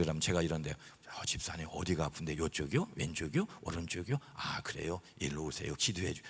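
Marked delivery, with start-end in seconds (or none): 1.2-6.2 soft, low, overly sweet pastor voice; mimicking gentle pastor. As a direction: coo at the deacon to mock him. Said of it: "저를 흉내를 내면서". (목사를 흉내 낸 부드럽고 낮은 목소리)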